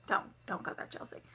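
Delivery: breathy whisper to herself; whispered aside. Real, not acted. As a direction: hush it, a note to self.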